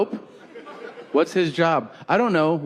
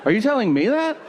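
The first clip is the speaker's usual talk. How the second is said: incredulous; 8 semitones higher, faster than usual. Retold or imitated. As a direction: ask it in total disbelief, pitched up. Incredulous reply to guard.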